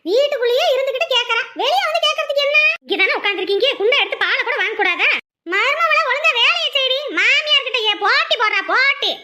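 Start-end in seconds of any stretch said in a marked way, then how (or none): 0.1-2.6 high-pitched nagging complaint; irritated mother-in-law's high scolding. Acted. Mother-in-law scolds daughter-in-law. 5.5-9.1 high, bossy mother-in-law rebuke; nagging in a high voice; scolding. Acted. Ordering daughter-in-law to work.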